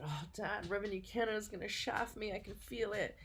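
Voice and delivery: playfully imitating her own whining to her father. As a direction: whine to dad like a worried kid. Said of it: "I went whining to my dad".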